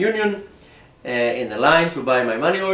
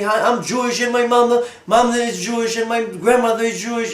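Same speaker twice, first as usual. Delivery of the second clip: excited gushing; 9 semitones higher than usual. Retold or imitated. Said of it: "And he".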